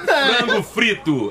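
mock-careful, laughing slow-down; playfully drawn out. Real, not acted. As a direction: stretch each word out with a laugh in it. Jokey translation of the English name.

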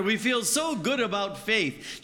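high, bright-eyed eager plea; eager faith. Acted. A believer on a good day, eagerly sure of faith. Preacher sums up good days.

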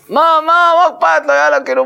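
very high, held-up cry of why not; acted exasperated protest. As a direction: push the voice way up in mock protest. Acting out the rabbi's protest. (מחאה משוחקת בקול גבוה מאוד)

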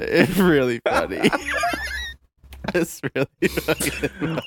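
can barely get it out for laughing. Real, not acted. Friend laughing at something on his Twitter, giggling.

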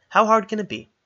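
high, loud, exasperated; defiant exasperation. Acted. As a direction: toss it off in defiance. Arwen storms off defiantly.

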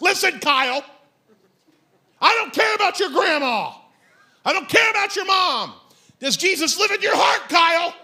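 loud, high confrontational yell; hammed-up furious challenge; exasperated shout. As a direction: shout Kyle down like a fired-up preacher. Acted rebuke of the show's Kyle.

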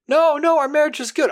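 high, loud, anxious denial; pleading husband. Retold or imitated. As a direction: protest high and loud, almost panicked. Husband denying to doctor.

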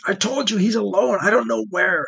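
high, strained pleading; desperate insistence. Acted. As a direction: insist in anguish. Tortured prisoner insisting.

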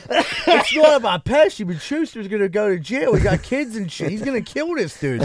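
retold urgent objection; worried plea. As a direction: plead for sense, half laughing. Retelling friend's worried plea.

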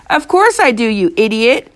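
exasperated shout. A narrator's reading. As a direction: shout back, outraged. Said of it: "I shouted".

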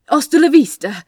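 sudden loud blurt, pitched well up. A narrator's reading. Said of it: "Aziraphale blurted out".

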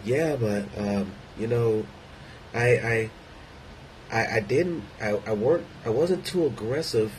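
soft, hesitant, innocent-sounding voice; calm victim tone. Retold or imitated. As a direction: play the harmless victim, gently. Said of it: "let me try to do the tone".

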